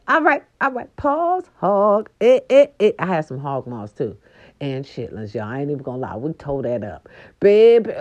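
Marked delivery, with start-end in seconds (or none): none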